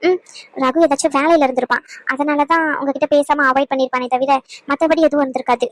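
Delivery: ordinary talk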